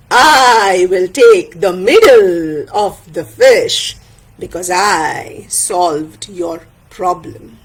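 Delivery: very slow, deliberate pronouncement; ponderous elder with a self-pleased chuckle; Tayu, sly elder judge. Acted. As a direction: hand down the ruling, stretching each key word.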